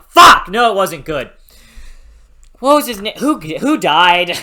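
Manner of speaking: real anger, yelling out the curse loud and high; furious outburst. Real, not acted. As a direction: blow up at the book, shouting. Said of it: "I was so pissed".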